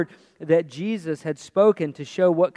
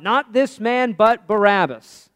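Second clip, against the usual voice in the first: crowd's loud, raised objection; crying out; 5 semitones higher, 7 dB louder, slower than usual. Acted. Mob answers Pilate's offer of release, crying out. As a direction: cry it out higher and louder, landing hard on the name. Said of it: "And they cried out".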